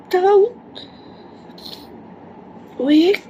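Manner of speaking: high tearful plea; crying. Real, not acted. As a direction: beg through tears. Pleading while crying.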